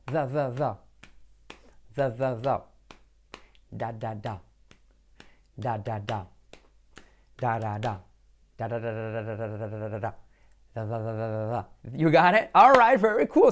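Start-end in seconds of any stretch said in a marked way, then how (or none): 0.1-2.6 the with the tongue out and voice on. Shown as a demonstration. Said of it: "Repetid conmigo". 3.7-11.6 da with the tongue kept in; softer d drill. Shown as a demonstration. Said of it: "Repetid conmigo".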